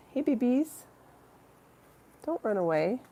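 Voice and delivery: high cooing call to the cats; sing-song greeting for pets. Real, not acted. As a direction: coo at the kitties like babies. Calling to her cats.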